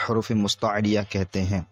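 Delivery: ordinary delivery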